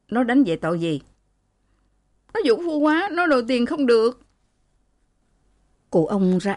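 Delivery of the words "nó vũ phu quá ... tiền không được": high-pitched, acted tearful complaint; distressed wronged daughter; plaintive accusation. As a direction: complain of ill-treatment in a high voice. Beaten daughter complains of her husband.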